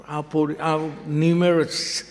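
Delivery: ordinary talk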